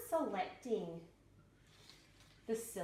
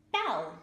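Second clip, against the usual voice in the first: "bell" said much louder, falling; announcing the word; 10 dB louder than usual. Shown as a demonstration. Teacher presenting a new word bell, loud. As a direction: call out "bell" clearly and loud. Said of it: "Another one might be".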